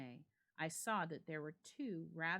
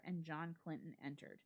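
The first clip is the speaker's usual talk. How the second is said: ordinary delivery, nothing marked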